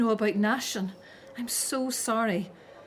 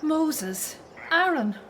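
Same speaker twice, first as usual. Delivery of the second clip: higher, urgent call to the returning brothers; anxious calling out; 6 semitones higher, slower than usual. Acted. Miriam calls to Moses and Aaron, anxious for news. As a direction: call out to them, desperate for news.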